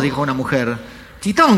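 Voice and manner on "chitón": high, loud bark for silence; acted sharp shout; shouted hush. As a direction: order silence across the cinema. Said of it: "gritó otro tipo".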